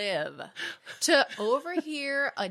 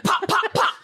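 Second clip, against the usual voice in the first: higher, louder gunshot pops; mock gunshot pops; 10 semitones higher, 6 dB louder, slower than usual. Mock gun noises in the made-up story about her dad. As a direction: pop each shot out like a cartoon gun.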